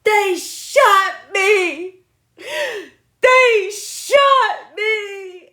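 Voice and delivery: put-on high, loud, drawn-out wail of a kid; kid's shout. Retelling the boy leaving the shot room, crying shout. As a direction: wail it like a kid who thinks he's been shot. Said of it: "He said".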